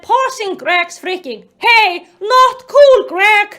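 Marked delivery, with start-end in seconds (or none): 1.6-3.5 firm, loud, raised reproach; scolding. Acted. Allison interrupting Greg over his joke, loud scolding. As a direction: call him out sharply.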